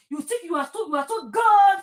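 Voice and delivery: ordinary talk, nothing marked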